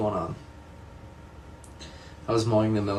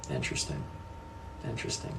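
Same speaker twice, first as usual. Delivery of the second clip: quiet musing; 7 semitones lower, 13 dB quieter, faster than usual. Real, not acted. Musing on a chat comment.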